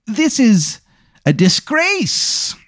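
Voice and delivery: high, drawn-out outraged voice; mock outrage. Chet Lightning condemns the book burners, mock outraged and high. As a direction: act scandalised in a high voice.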